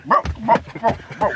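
high, loud flapping-shoe noise; comic repeated shoe-flap sound; imitated talking shoes. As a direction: make the shoes talk in short bursts. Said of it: "My shoes literally talked when I walked".

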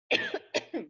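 sharp high cough; coughing fit. Real, not acted. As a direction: cough hard again.

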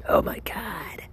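breathless whispered awe; awed whisper. Real, not acted. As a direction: whisper it as if overwhelmed by the taste. Tasting the chocolate, awed.

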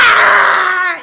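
a loud, high startled scream; sudden shriek. Retold or imitated. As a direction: let out a panicked yell. Friend leaves, sudden yell. (grito agudo de pánico)

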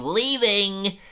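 high, drawn-out cartoon professor cry; Professor Frink. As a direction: imitate a professor's robot clone calling out. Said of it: "all these clone robots of Frink".

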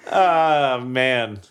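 usual speaking voice

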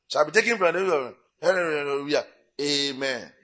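drawn-out, droning scripture announcement; mock Bible reader. Preacher mimicking the Bible reading slot in church, mock-solemn drone. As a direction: stretch it out like a ponderous church reader.

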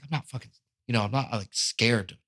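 hushed, unconvincing claim of not being scared; quiet mock bravado. Kid behind the counter quietly telling himself he's not scared. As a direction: say it softly, half to himself.

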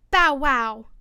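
loud, playful bow-wow; story-reader's loud mock bark; daisy's cried bark. A narrator's reading. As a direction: bark it out like a little flower. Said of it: "cried a daisy".

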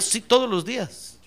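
slow, unhurried insistence; firm confirmation. Acted. Pastor answering the balking helper, slow firm confirmation. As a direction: confirm it firmly, without raising the voice.

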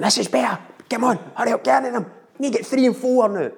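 coach yelling. Retold or imitated. Opposing coach yelling orders.

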